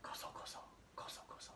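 very soft, voiceless whisper; hushed secretive whisper. Shown as a demonstration. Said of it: "They're whispering".

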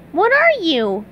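high cartoon astonishment; SpongeBob asking. A narrator's reading. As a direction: ask the stranger in a high voice. SpongeBob questions the stranger.

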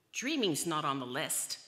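loud storybook roar. A narrator's reading. Nine Leos scolding the dreamer, roaring. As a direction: bellow the rebuke without raising pitch much. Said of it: "they roared".

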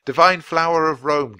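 loud call of the name. Acted. Jack calls the unicorn's name, loud. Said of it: "Jack called".

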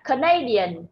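"Canadian" as ca-NA-di-an; stress on second syllable. Shown as a demonstration. Teacher modelling the adjective, "Canadian" stressed on NA. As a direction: lean on the NA of "Canadian". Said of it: "chữ thứ hai mình bấm âm".

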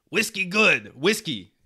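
a hint of a friendly Russian guy handing over "Whiskey good"; imitated Russian accent. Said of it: "He hands them to us and he goes".

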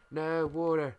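high, loud panicked cry at the water; alarmed shout. Real, not acted. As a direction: yell as the water rushes in. Water floods the build.

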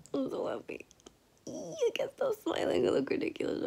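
high, unvoiced whisper. Real, not acted. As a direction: whisper it, half-embarrassed. Whispering, embarrassed giggly.

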